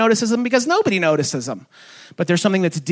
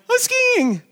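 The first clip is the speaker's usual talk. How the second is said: very high squeal of delight; thrilled kid. Retold or imitated. Little kid skiing for the first time, squealing. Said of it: "it's like".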